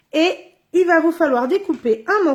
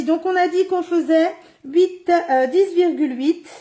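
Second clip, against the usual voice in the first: usual speaking voice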